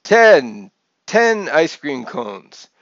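loud, drawn-out triumphant count; theatrical puppet count's shout; yelled. A narrator's reading. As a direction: yell the number out big and slow. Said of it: "he yelled".

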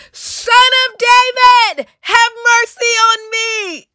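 very high, slow, sustained cry. A narrator's reading. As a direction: build into a high, drawn-out shouted plea. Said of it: "he only shouted louder".

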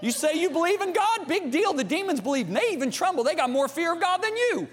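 high-pitched, forceful challenge; fired-up scolding from the pulpit; preacher's rebuke. Real, not acted. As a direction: confront the congregation, pitch held high. Preacher confronting hearers with scripture.

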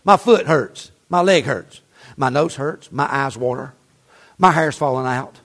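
mock tired churchgoer grumbling; whiny excuse-maker. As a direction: sigh through a list of lame excuses. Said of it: "why people make excuses".

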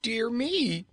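high, startled gasp of a word; surprised exclamation. Acted. Nome King hearing the news, startled. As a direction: caught off guard. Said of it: "exclaimed Kaliko".

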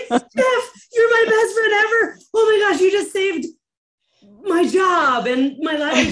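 high, loud, laughing wail of thanks; mock tearful joy. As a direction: play up a happy sobbing shout to a rescuer. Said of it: "and I was crying".

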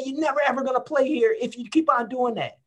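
high, strained and loud coach's rant; mock tirade of an old football coach; imitating coach yelling. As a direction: chew out a player on the field. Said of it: "He said".

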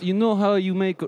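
put-on high, hammy Russian accent; broken English in a mock Russian accent. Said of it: "Yeah, you have good accent".

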